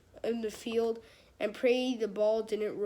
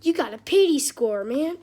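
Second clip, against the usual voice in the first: loud teasing; 4 semitones higher, 10 dB louder than usual. Real, not acted. Teasing the kid in the photo.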